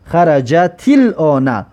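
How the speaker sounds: "خرجت الان" drawn out as one chain, xa-ra-ja-til-ona; linked with kasra. Shown as a demonstration. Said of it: "فهمایی را در یادتون بگیرید همچنین". («خرجت الان» کشیده و پیوسته، با کسرهٔ تا)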